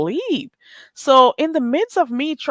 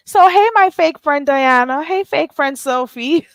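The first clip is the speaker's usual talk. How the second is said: sing-song "hey", higher and sugary, hamming it up; mock fake greeting; 6 semitones higher, 4 dB louder than usual. Teasing her fake friends on stream with mock sweetness. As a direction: greet them like a phony best friend. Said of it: "let me be fake and phony with you".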